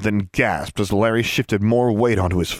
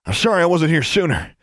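mildly breathless apology, pitched up; a touch winded and urgent; panted; 7 semitones higher than usual. A narrator's reading. Rescuer apologises to the girl, panting. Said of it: "he panted".

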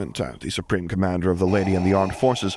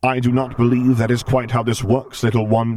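protesting Vader; 5 semitones higher, 5 dB louder than usual. A narrator's reading. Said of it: "he attempted to protest".

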